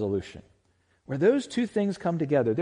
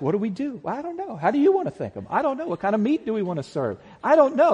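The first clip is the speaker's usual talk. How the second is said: high-pitched, fretful back-and-forth; flustered hosts; 7 semitones higher, 4 dB louder, faster than usual. Acted. Hosts puzzling over a meal for Jesus, flustered. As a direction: play two hosts at a loss, pitched well up.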